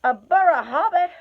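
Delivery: high, loud, puzzled troll question; startled trolls. Acted. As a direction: trolls baffled by the new word. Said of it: "said they, a bit startled".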